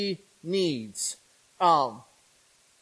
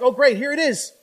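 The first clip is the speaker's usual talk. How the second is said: higher and louder, eager and thrilled; eager excitement; 6 semitones higher, 5 dB louder than usual. Retold or imitated. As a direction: burst out like your turn has finally come. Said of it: "you're excited".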